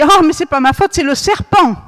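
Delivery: mock-indignant, blaming the serpent; whiny excuse. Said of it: "Et Ève a dit".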